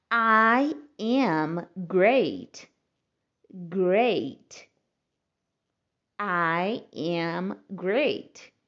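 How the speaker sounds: "I am great" drawn out very slowly; slow model. Shown as a demonstration. Teacher models "I am great" slowly for learners. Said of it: "Eu estou ótima".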